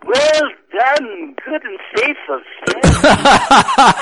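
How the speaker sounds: mock-solemn British pronouncement in heaven; posh English accent. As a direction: intone it like a lofty English voice from heaven. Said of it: "sent to help Americans".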